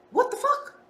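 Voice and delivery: high-pitched squeak of amazement; stunned disbelief. Retold or imitated. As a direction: squeal it like you can't believe it. Inner quote of amazed disbelief.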